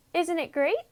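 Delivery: put-on high, quick, cheerful young sheep voice; Wilbur the lamb. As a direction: chirp it like a proud little lamb.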